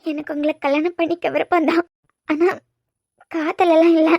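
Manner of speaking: halting, tearful admission. Acted. Tells him she'll marry without love, tearful. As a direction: admit it through tears. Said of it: "கொஞ்சம் நிப்பாட்றியா?".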